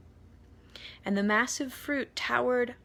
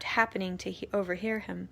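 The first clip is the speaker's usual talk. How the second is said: ordinary delivery, nothing marked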